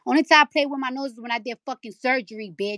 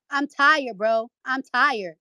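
normal talking voice

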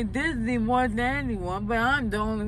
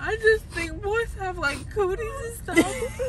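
teary; 7 semitones higher than usual. Real, not acted. Groggy, crying.

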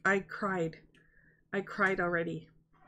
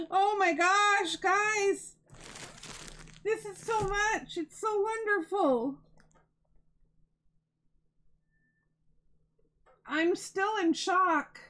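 much higher and louder, bursting with joy; so excited; 8 semitones higher, 3 dB louder than usual. Real, not acted. Thanking viewers for the yarn, a high, loud, overjoyed squeal. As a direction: squeal it out, overwhelmed with happiness. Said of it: "I'm so excited".

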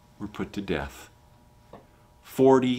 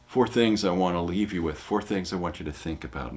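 usual speaking voice

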